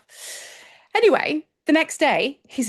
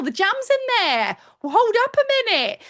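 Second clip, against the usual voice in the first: excited; 10 semitones higher than usual. Real, not acted. Excited correction in analogy.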